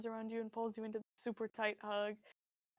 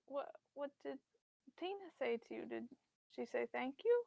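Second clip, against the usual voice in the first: frail sympathetic mother; 6 dB quieter than usual. Acted. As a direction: comfort him softly. Mother voice soft.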